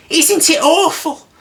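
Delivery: very high, slow frightened squeak; scared Piglet voice. Acted. Piglet clutching Christopher Robin, very high slow squeak. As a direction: quaver like a terrified piglet. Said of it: "doing that Piglet voice screaming".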